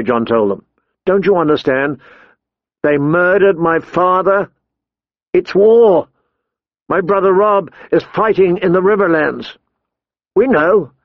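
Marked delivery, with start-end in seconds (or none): 1.1-9.6 higher-pitched, urgent explanation; impassioned John. Acted. John justifies his desertion to his friends, impassioned. 10.3-10.9 higher-pitched, mildly solemn; Pip. A narrator's reading. Pip acknowledges John's loss, solemn. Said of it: "Pip said solemnly".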